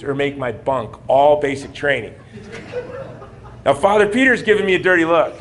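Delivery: ordinary delivery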